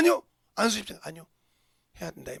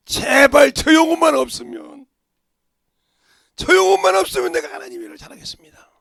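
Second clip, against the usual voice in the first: desperate prayer; 9 semitones higher, 15 dB louder than usual. Retold or imitated. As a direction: cry out to God, begging. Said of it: "주님 앞에 기도한 겁니다".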